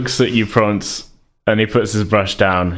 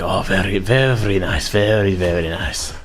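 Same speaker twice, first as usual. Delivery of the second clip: low muttered praise; 5 semitones lower, 3 dB quieter than usual. Acted. Artist voiced muttering praise.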